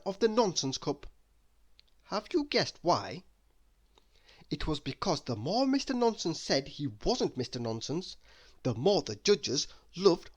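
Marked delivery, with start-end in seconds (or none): none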